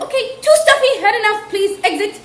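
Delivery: high, loud mock-impatient baby. Baby in the womb, mock impatience. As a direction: play a fed-up unborn baby. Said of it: "she was like".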